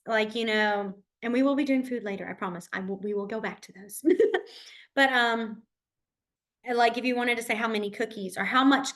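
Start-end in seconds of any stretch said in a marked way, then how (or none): none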